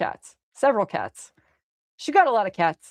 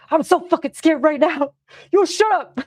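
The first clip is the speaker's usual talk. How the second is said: scared shout; 9 semitones higher, 5 dB louder than usual. Retold or imitated. Retelling her own scared yelling.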